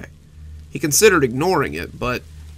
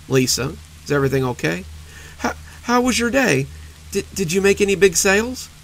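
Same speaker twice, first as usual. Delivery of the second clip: anxious, pitch climbing higher; nervous questions, stumbling over words; worried husband; 6 semitones higher than usual. Acted. Worried husband probing his wife.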